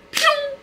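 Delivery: sharp high burst sound of sudden pain; mock pain burst. Describing eye pain, a high loud squealed pew.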